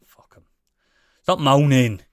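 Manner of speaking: ordinary talk, nothing marked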